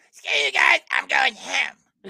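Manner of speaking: high, loud cartoon shout with drawn-out ending; Cartman. Shown as a demonstration. Correcting a friend's impression, loud Cartman voice. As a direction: shout it in character, stretch the last words. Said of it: "Cartman's more deeper".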